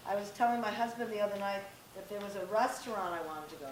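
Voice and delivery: usual speaking voice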